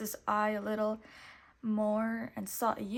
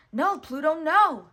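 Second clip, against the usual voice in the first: loud, high alarmed shout at the dog; 6 semitones higher, 12 dB louder than usual. A narrator's reading. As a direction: yell to stop the runaway dog. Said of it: "shouted Mickey".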